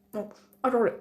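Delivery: talking through foam; while brushing teeth. Real, not acted. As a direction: mouth full of toothpaste. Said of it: "j'étais en train de me brosser les dents".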